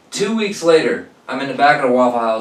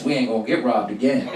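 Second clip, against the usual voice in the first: normal talking voice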